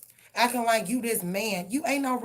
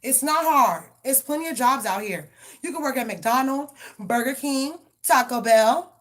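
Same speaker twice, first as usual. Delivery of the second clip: louder and higher, yelling orders; angry shout; 4 semitones higher, 6 dB louder than usual. Real, not acted. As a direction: bark it at someone who needs a job. Ranting at a stalking ex.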